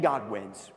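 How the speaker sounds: "God wins" again low and certain; low, final; low firm declaration. Acted. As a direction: state it as final. Repeated declaration.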